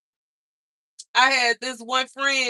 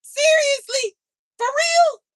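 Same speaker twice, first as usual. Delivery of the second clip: high squealing disbelief, rising higher each line; mock disbelief; 11 semitones higher, 3 dB louder than usual. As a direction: squeak it out like you can't believe it. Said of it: "And I'm like".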